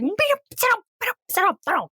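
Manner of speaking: high, squeaky-pitched rapid-fire imitation; hammed-up yelping, leaping up in pitch; mock outburst. Imitating girlfriend's outburst at boyfriend.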